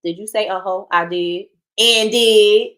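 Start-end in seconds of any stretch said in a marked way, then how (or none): 1.8-2.7 much louder, proud and pointed; cheeky defiant boast; defiant quip. Real, not acted. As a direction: own it out loud. Teasing admission.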